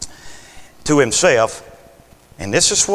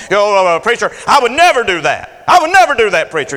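mock protest; 2 semitones higher than usual. Churchgoer protesting to the preacher, rising insistence.

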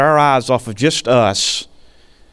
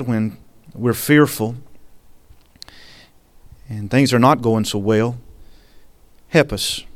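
closing prayer; 3 semitones lower than usual. Acted. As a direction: pray it gently over the congregation.

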